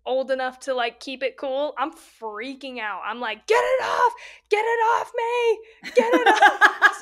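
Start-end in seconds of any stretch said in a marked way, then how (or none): 3.5-7.0 high, loud shriek breaking into a laugh; retold panicked scream. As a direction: shriek to get the snake off her. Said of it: "Just screaming".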